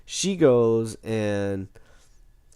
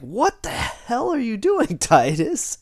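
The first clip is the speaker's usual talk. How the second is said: startled outrage; 11 semitones higher, slower than usual. Retold or imitated. Jermaine startled by the attack.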